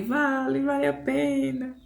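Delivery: retold tearful reply. Woman answering God that it is worth it, imitated tearful voice. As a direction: draw the words out in a high, imitated weepy voice. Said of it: "Aí eu chorando falei".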